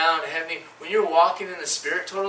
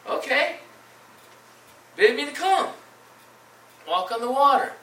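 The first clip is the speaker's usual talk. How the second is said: much higher, eager plea; voicing eager Peter; 8 semitones higher than usual. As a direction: ask to join him on the water, keen and high. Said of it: "So he's like".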